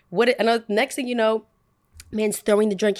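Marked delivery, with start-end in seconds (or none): none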